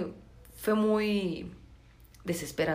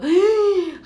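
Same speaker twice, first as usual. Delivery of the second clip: high, loud mock gasp; 13 semitones higher, 8 dB louder than usual. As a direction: gasp like something shocking happened. Jadeo de asombro. (jadeo fuerte y agudo de asombro)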